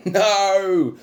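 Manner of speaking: high, loud drawn-out scream. Acted. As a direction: scream no in despair. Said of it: "Plank screams".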